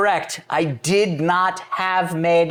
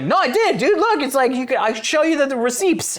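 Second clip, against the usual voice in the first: insisting excitedly; 7 semitones higher than usual. Retold or imitated. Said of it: "And I was like".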